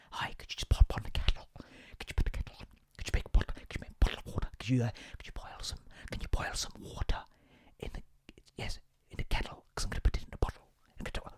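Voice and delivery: soft unvoiced whisper throughout; mock annoying whisper. Shown as a demonstration. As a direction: whisper it with the k-sound t's. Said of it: "I hate that more than whispering".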